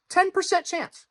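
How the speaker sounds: very high-pitched mock emphasis; exaggerated squeaky repeat. Video host granting doubters a ten percent chance, squeaky mock emphasis. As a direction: leap way up high, hamming it up.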